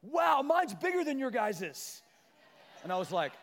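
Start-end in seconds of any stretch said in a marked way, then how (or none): 0.0-2.0 put-on high, loud, slow boast of a child; bragging kid. Tyson brags to his siblings about his cookie, high and loud. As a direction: gloat like a little boy. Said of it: "And Tyson's like".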